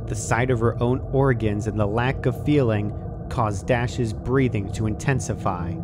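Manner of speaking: ordinary delivery, nothing marked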